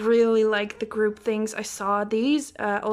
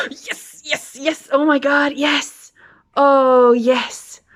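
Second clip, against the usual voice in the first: ecstatic cries; 3 semitones higher, 10 dB louder, slower than usual. Real, not acted. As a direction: cry out in pure joy. Cries out at ultimate bias card.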